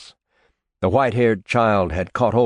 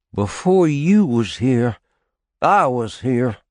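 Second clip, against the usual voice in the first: each word slow and weighted, voiced higher; slow, emphatic; 6 semitones higher, 4 dB louder than usual. Tarwater hurling words at the small boy, slow and emphatic. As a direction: lay down a claim, word by word. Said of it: "he said in a slow, emphatic voice".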